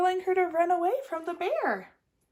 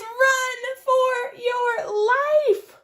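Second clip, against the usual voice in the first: very slow, high, loud frightened cry; panicked warning; 10 semitones higher, 6 dB louder, much slower than usual. Acted. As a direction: cry it out in terror, stretched long. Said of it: "Raccoon gives her a piece of advice".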